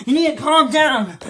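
usual speaking voice

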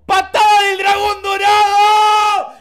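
a big, high, slow shouted move name; battle cry shout. Pretend. Hero yells his special kick at two thugs, high slow shout. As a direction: yell the attack name like an anime hero.

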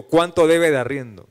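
loud, drawn-out imitation of a repeated question; insistent demand. Employer pressing the pastor about his debt, insistent demand. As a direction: bear down on the question, slow and loud. El hombre repite la pregunta, insistente.

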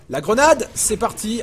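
loud, excited grenade callout; grenade warning yell. Real, not acted. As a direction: call it out as you throw. Throwing a grenade mid-game.

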